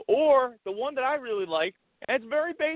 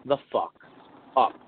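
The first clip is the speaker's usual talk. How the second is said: low and measured, long pauses; stern pretend warning; 8 semitones lower than usual. Pretend husband warning his wife, stern. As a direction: space out each word as a final warning. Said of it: "and you said".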